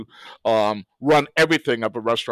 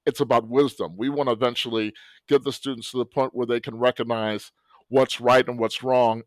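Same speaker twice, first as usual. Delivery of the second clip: ordinary talk, nothing marked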